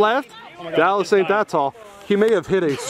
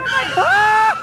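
excited screaming. Real, not acted. Screaming during close play.

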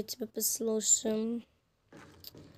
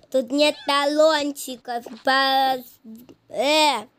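higher, much louder reception worker; loud refusal; 3 semitones higher, 9 dB louder, slower than usual. Acted. Reception worker turning away a patient, loud and high. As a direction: call it out loudly, raised pitch.

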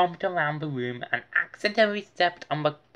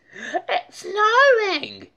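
high, loud, slow joyful shout; excited shout; 8 dB louder, much slower than usual. Acted. Arthur seeing the snow, shouting with joy. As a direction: shout it in wonder. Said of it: "he shouted".

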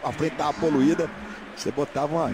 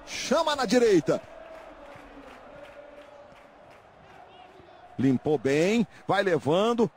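live football commentary, rising; play-by-play commentary; 7 semitones higher, slower than usual. Acted. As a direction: call the action as it happens.

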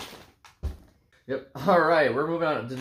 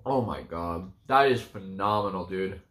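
talking while eating candy; chewing between words; mouth full, chewing; 7 semitones lower than usual. Real, not acted. Seen 2: Hand at mouth, popping candy pieces and chewing while talking.

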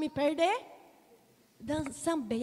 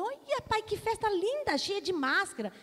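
put-on high, eager child voice; a small girl tugging at her dad; excited little girl; 7 semitones higher than usual. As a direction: play yourself at three, thrilled by the parade. Child speaking to father.

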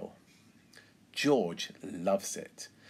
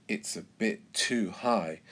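usual speaking voice

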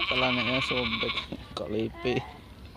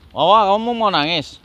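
teasing call; 10 semitones higher, 15 dB louder than usual. Real, not acted. Teasing a child about crying.

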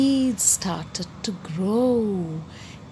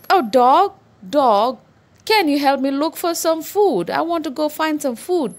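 higher-pitched pleading hen; eager little hen; 6 semitones higher than usual. Acted. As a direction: call out and ask for help, pitched up. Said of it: "The little red hen then went to the dog".

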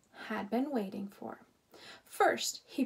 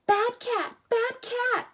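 put-on much higher, loud child's scolding yell; shouting scold; 13 semitones higher, 8 dB louder than usual. A narrator's reading. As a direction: call out across the camp at the cat. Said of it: "Lena shouted".

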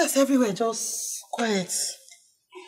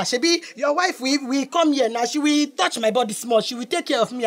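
usual speaking voice

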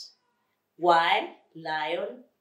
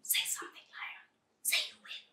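soft, voiceless urging; whisper; 8 dB quieter, faster than usual. Acted. Flea prompting the lion before the animals, urgent whisper. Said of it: "whispered Flea".

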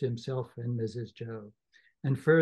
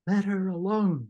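higher, louder plea to stop; Joe interjecting; 5 semitones higher, 4 dB louder than usual. Acted. Joe telling Orlick to leave his wife. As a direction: step in firmly but mildly.

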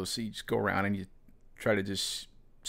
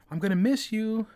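high, louder mock girl voice; girl's goodbye; 12 semitones higher, 4 dB louder than usual. Narrator imitates his ex saying she'll miss him, high and girly. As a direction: mimic her sugary farewell, pitched way up.

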